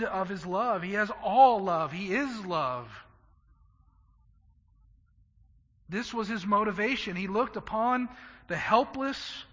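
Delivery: loud, rising preacher's climax; full-force preaching. Acted. Pastor to the congregation about Christ's love, preaching loud and fervent. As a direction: build it up and drive every phrase home.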